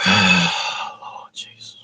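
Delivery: sighed, slow breathy whisper; exasperated whisper. Real, not acted. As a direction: whisper it under a heavy sigh. Exasperated sigh at the app.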